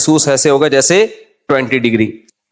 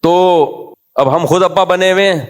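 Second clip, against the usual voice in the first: usual speaking voice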